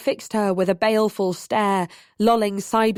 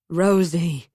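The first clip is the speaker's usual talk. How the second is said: slurred, drowsy name; drunk slurring; 3 semitones lower than usual. A narrator's reading. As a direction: say it woozy and drunk. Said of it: "he slurred".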